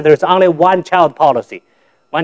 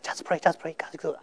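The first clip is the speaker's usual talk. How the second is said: barely audible, softer and fading; faint weak voice; 17 dB quieter than usual. Retold or imitated. Sick pregnant wife reassuring her husband, very faint. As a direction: answer as if almost too weak to speak.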